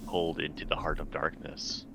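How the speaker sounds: normal talking voice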